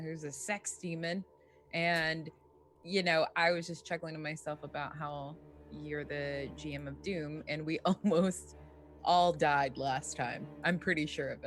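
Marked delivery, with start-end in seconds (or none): none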